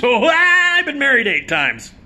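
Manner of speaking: boasting Mickey Rooney. Retold or imitated. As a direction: crow about it like a vain old showman.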